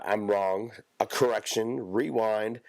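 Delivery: ordinary talk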